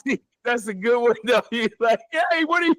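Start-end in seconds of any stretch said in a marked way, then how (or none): 2.1-2.7 high, shouted imitation of panic; mock panic. Listener acts out teller's panic.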